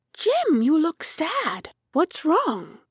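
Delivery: put-on high, childlike worried voice; small character's anxious questioning, swooping high; concerned little tractor. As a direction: ask with high-pitched concern. Tractor worries about his sad friend.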